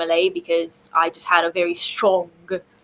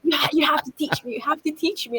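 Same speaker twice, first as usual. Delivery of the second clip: pleading friend; 6 semitones higher, 5 dB quieter than usual. Retold or imitated. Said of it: "then she was like".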